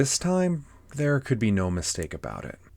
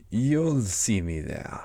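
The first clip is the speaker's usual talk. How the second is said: slow, drawn-out cat voice; Cheshire Cat; slower than usual. Acted. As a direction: stretch the words out like a leisurely storybook cat. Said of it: "said the cat".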